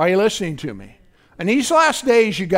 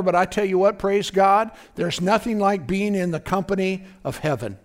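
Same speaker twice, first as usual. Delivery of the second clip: ordinary delivery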